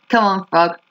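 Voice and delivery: ordinary delivery, nothing marked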